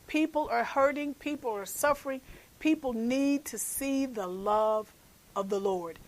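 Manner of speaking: usual speaking voice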